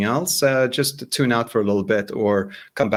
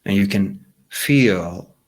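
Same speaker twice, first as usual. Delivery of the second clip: calm meditation voice with long pauses; guided meditation. Real, not acted. Guide asking listeners to picture their wish, calm meditation voice. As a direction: lead them gently inward, leaving silence between phrases.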